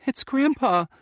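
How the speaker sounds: high, loud horrified cry; shocked disbelief. Acted. Faye tells the narrator she hears her grandpa, horrified and high. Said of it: "she said in a horrified voice".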